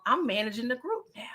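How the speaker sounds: hushed, quick whisper. Retold or imitated. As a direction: lean in and whisper it fast. Duck's manager pitch retold.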